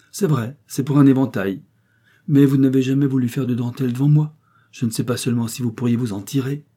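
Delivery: mildly challenging woman in a story; lightly voiced Madame Bocken, direct. A narrator's reading. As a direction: lightly play a woman pressing her point.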